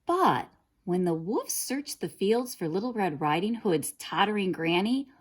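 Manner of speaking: ordinary delivery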